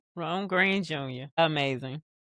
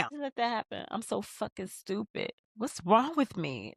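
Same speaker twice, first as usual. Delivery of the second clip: mildly higher, mock upset voicing of Issa; a light take on a guilty woman blaming herself; upset self-blame; 6 semitones higher, 5 dB quieter than usual. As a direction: play it like she's kicking herself. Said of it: "That's what Issa said".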